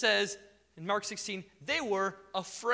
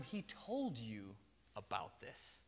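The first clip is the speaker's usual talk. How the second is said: slow and much softer, almost hushed; hushed angel; 8 semitones lower, 13 dB quieter, slower than usual. Acted. Angel to the frightened women, slow hushed reminder. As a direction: lean in and say it gently, slowly. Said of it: "the angel tells them".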